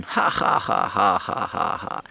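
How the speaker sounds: mock laugh in a run of ha-ha-has; imitated group laughter. Locals all laughing at his remark, imitated laughter. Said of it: "they're all laughing".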